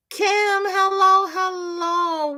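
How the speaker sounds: high, slow sing-song greeting; sing-song hello. Real, not acted. Crafter greeting a viewer with a high sing-song hello. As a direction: stretch out a bright hello.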